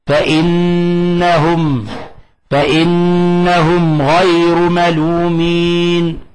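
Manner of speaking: very slow chanted verse; slow recitation. Shown as a demonstration. Teacher recites the example verse, slow chant. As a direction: stretch the verse out calmly. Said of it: "كما في قوله تعالى".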